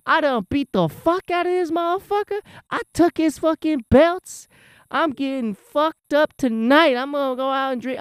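pumped-up winner mouthing off; hyped trash talk. Pretend. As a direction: crow like a champ who just took the belts. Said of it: "I'd be saying".

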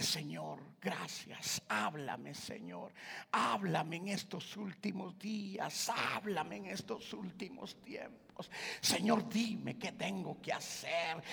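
preacher guiding a whispered prayer to the Lord; led prayer. Acted. Leads the congregation in prayer.

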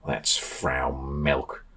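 slow, low, soft mutter; low grumbled aside. A narrator's reading. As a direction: mutter it under your breath, dragged out. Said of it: "Ollie started to mutter".

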